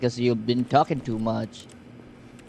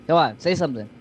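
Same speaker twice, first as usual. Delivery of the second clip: ordinary delivery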